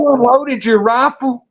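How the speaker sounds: high-pitched disbelief; incredulous. Retold or imitated. Hunter learning the buddy's rifle is empty, incredulous.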